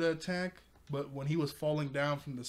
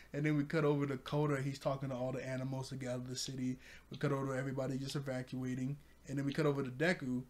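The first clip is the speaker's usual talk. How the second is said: ordinary delivery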